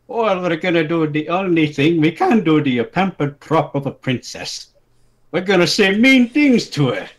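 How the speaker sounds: high, loud gleeful threat; declaring brute. Acted. Short man threatening the princess, loud and gleeful. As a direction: announce it with relish. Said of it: "the other declared".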